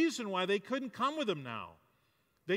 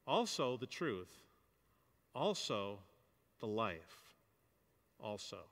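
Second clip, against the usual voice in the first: quiet Message reading; 7 semitones lower, 5 dB quieter than usual. Retold or imitated. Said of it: "as Eugene Peterson does it".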